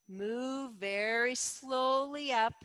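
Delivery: slowed-down cue, drawn out; slow your words to match the move. Shown as a demonstration. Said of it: "accentuate the word slow".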